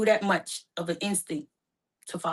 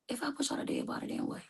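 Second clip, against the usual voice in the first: fearful whisper; 6 semitones higher, 12 dB quieter, faster than usual. Real, not acted. Fear of stillbirth.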